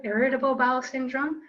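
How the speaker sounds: ordinary delivery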